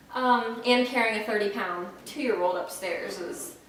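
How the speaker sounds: usual speaking voice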